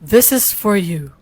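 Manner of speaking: low, slow AI assistant voice; Google Gemini voice. Acted. Reading out the chatbot's response, Google Gemini voice. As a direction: intone it slowly like a machine. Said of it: "Maghimo ka Google Gemini voice".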